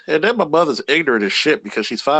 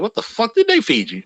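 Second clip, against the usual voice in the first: shocked grandmother; 11 semitones higher than usual. Retold or imitated. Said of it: "be like".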